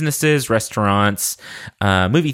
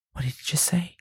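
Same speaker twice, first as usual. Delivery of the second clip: mock shock; 6 semitones higher, 8 dB quieter than usual. Mock shock at own words.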